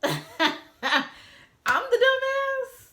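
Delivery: very high, loud, drawn-out disbelief after a laugh; incredulous outrage. Acted. Wife throwing husband's insult back at him, incredulous.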